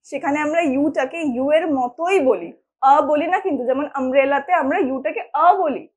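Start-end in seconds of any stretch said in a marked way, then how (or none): none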